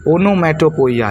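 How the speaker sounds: ओनोमैटोपोइया with an extra "o-i" before the "ya"; wrong pronunciation. Shown as a demonstration. Teacher demonstrating the students' mistake, ओनोमैटोपोइया said with "poiya". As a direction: end ओनोमैटोपोइया in "po-i-ya". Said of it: "ये इसका correct pronunciation नहीं है".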